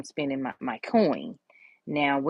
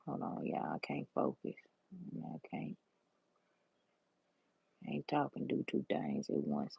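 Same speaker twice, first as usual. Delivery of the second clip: whispered aside; 14 semitones lower, 15 dB quieter than usual. Real, not acted. Distracted aside while doing nails.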